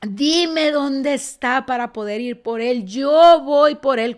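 mildly acted angry demand, loud at the end; angry reproach. Mary accuses the man she thinks is the gardener, a mild acted angry demand. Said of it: "está tan enojada con él".

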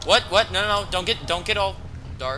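high, loud alarmed protest; panicked rapid repeats, voice jumping up; real panic. Real, not acted. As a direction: plead loudly as dread hits. Startled as the game darkens.

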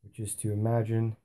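soft, unhurried meditation voice with long pauses; guided meditation. Acted. Teacher leading listeners into a blue-sky meditation, softly. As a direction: guide them gently into stillness, hushed and spacious.

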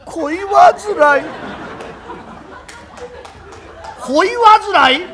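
slow, high, bursting with held-back laughter; stifled laughter. Acted. Kuma echoes the confession, choking on laughter. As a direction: repeat it while trying not to laugh.

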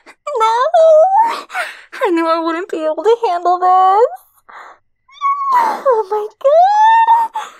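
high, loud, crying out with joy; sobbing joyful shout; overwhelmed cry. Acted. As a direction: let the joy break out in a shout. Overwhelmed after love returned.

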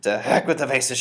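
loud, reckless dismissal; shouted. A narrator's reading. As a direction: shout the worry away. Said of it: "she shouted".

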